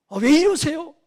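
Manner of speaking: sudden loud, high-pitched protest; high outburst, acting wounded and defensive; acted hurt yell. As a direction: yell it like someone touched on a sore spot. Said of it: "막 이런 소리 지르겠어요".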